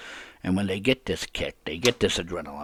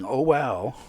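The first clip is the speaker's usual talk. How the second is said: mock cheerful; 6 semitones higher, 5 dB louder than usual. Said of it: "with a happy face on going".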